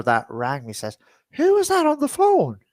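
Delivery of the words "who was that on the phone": quoting the relative. Retold or imitated. Relative asks, quoted.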